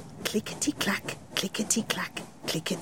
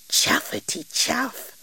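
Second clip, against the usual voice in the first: train chuffing; 4 semitones higher, 3 dB quieter than usual. Acted. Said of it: "went Jane".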